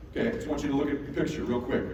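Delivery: ordinary talk, nothing marked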